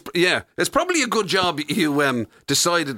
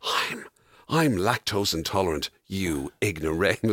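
much lower, softer, slow sneer; deep mock-haughty retort; snooty offended guest; 11 semitones lower, 5 dB quieter than usual. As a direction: look down your nose at them.

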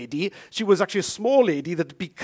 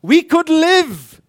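high, loud, slow proclamation; dramatic preaching; 9 semitones higher, 12 dB louder, much slower than usual. Acted. Preacher telling of the lepers, high slow loud. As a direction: let it ring out slowly.

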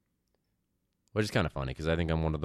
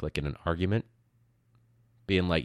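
ordinary talk, nothing marked

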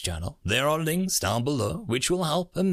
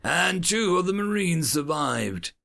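higher-pitched formal documentary voice; recorded battle report; 6 semitones higher than usual. A narrator's reading. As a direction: recite casualty figures as a military record.

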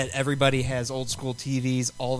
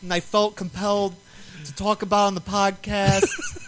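a hint of a bad New York accent, higher and louder; half-joking tough-guy impersonation; Jax Teller voice; 7 semitones higher, 4 dB louder than usual. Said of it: "do a Jax Teller impersonation".

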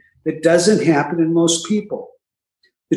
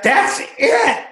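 mock firm vow; 10 semitones higher, slower than usual. Voicing a normal drinker's resolve.

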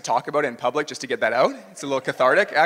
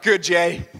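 a higher, louder, hammed-up pat on the back; mock praise; 3 semitones higher, 8 dB louder than usual. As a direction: play the friends cheering him on, teasing. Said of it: "my friends are like".

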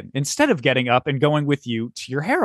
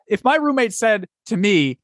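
usual speaking voice

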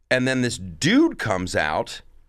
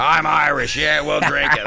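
loud, high comic holler; rowdy stage bit; 5 semitones higher, 5 dB louder than usual. Acted. Comic reprising his old Irish bit, rowdy shout. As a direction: holler it like a drinking boast.

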